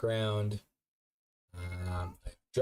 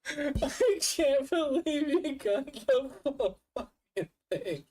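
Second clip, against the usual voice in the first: laughing hard; 13 semitones higher, slower than usual. Real, not acted. Streamer laughing at captured clip.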